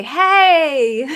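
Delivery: loud laughing greeting; laughing hello. Real, not acted. Host greeting a viewer who joined, laughing. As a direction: burst into a laugh as you say hey.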